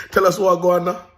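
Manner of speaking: put-on lightly Jamaican-accented call, high and loud; Jamaican patois. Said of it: "Like my Jamaican people will say".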